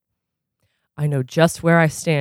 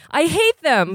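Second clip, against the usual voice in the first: cries out; 10 semitones higher than usual. A narrator's reading. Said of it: "Blot cries out".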